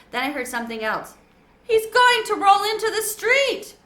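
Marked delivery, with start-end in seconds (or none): 1.6-3.7 high, loud panicked shriek. A narrator's reading. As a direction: scream a warning in panic. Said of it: "Rosie shrieked".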